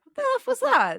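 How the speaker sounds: high, loud disbelieving outburst; incredulous. Retold or imitated. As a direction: react like you can't believe it. Reacting to odd dance scene.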